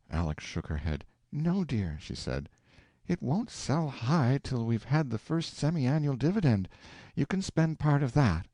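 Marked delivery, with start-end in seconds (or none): 1.3-2.0 lightly voiced wife, higher and reproachful; a hint of a shocked wife pleading; gentle higher wife's reproach; Aleck's voice. 3.1-8.5 lightly voiced wife, higher and reproachful; a hint of a shocked wife pleading; gentle higher wife's reproach; Aleck's voice.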